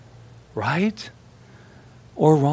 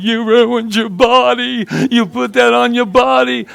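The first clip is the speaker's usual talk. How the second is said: mock motherly lament, much higher; scolding Italian mother; 9 semitones higher, 3 dB louder than usual. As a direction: imitate a mother's high-pitched, long-vowelled scolding. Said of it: "right now she's going".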